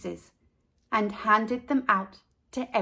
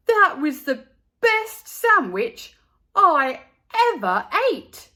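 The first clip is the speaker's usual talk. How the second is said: delighted; 7 semitones higher, 7 dB louder than usual. A narrator's reading. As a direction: relish every word. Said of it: "he said".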